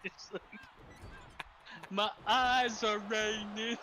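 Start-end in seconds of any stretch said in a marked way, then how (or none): 1.9-3.8 slow, slightly raised film-character impression; Simple Jack. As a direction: draw it out as a mock movie quote. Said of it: "A simple Jack".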